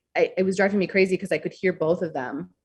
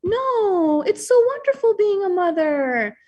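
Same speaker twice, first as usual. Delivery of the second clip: much higher, sing-song mother voice; imitating her mom; 14 semitones higher, 4 dB louder, slower than usual. Mother brushing off her tired daughter, sing-song. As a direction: gush like a doting mom brushing it off. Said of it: "And she would go".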